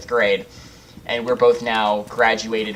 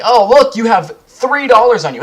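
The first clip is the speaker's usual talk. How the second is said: much higher and louder, fake delight; mock sarcastic dad; 11 semitones higher, 9 dB louder than usual. Dad mocking his son's empty wallet, sarcastic delight. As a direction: rub it in with mock surprise.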